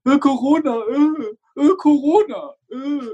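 high, drawn-out whining; mock miserable whiner. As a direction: groan it like someone slouching through life. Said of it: "mit seiner Körperhaltung so". (hohes, nachgeäfftes Gejammer)

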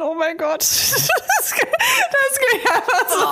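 high, squealing laughter through words; cracking up mid-sentence; laughing. Real, not acted. Laughing fit over own phrase.

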